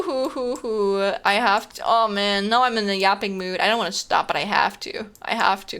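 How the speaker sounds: ordinary talk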